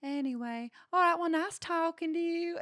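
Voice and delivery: imitating a breezy Southern woman's goodbye; Southern accent. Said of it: "she had a Southern accent too".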